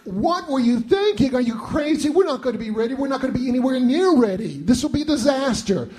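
high, alarmed protest of a startled partner; wife panicking about the Christmas invitation, alarmed; panicked quote. Retold or imitated. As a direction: cry out in alarm at the plan. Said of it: "she said".

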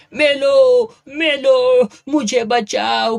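high, loud howl of pain, slowing on the plea; a snake charmer howling for mercy; wailing cry for help. A narrator's reading. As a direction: scream it like he is being beaten. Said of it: "लागला भुई बडवत बोंबलायला".

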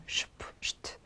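슈프, 슈트 whispered as "shp, sht"; SH clusters. Shown as a demonstration. Teacher isolates clusters for students, whispered SH. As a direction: give 슈프, 슈트 very softly without vowels. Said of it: "슈트가 되죠".

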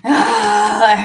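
a loud, real moan on the exhale. Real, not acted. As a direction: let it all out with a moan. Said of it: "exhale with a moan".

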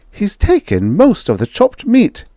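high, worried housewife voice; a wife upset about the kitchen; anxious high-pitched wife; fretting wife. A narrator's reading. Wife character voice.

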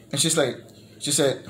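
usual speaking voice

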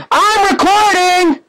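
high, drawn-out yell; calling out. Real, not acted. Gamer letting someone know a recording is on, calling out. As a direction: call it out high and long.

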